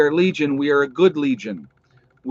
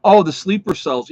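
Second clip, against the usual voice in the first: sudden recall; 6 semitones higher, 9 dB louder than usual. Real, not acted. Remembers topic from chat.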